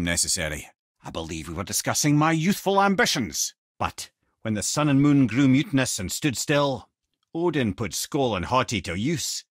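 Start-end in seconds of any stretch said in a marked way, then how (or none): none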